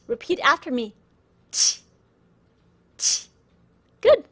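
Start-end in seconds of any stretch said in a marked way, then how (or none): 1.5-3.3 pinyin q, ch made with lips spread wide. Shown as a demonstration. Teacher contrasting sounds for learners, ch with widely spread lips. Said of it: "your lips are widespread".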